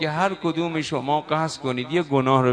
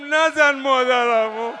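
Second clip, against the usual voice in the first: put-on slow, louder child's plea; pleading cry; 6 semitones higher, 6 dB louder, slower than usual. Hasan crying out to stop the attack on his mother. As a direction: call out to stop the blows.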